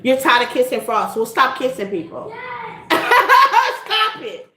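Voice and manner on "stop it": laughing it out, pitched up; real laugh breaking through. Real, not acted. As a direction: giggle through the words. Laughing at her own joke.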